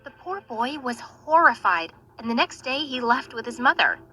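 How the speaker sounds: ordinary delivery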